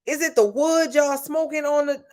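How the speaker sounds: high, excited exclamation of delight; amazed at the food. Retold or imitated. Recalling the Nashville pulled pork, high excited amazement. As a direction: gush like the barbecue just blew your mind. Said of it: "I was like".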